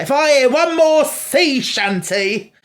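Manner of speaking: high, loud, slow exasperation; fed up and dragging it out; exasperated Donna. Retold or imitated. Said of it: "she's like".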